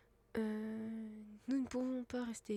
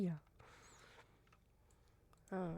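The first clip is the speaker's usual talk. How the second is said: ordinary delivery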